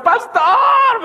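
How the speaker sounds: very high laughing call of pastor; laughing cry. Retold or imitated. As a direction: squeal it out through a laugh. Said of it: "me decía una hermana".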